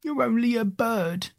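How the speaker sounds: high, slow whining put-on voice; tearful scorn. A narrator's reading. Gub Gub belittles the parrot, tearfully scornful. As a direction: draw it out in a high, snooty whine. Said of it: "turning up his nose and beginning to cry again".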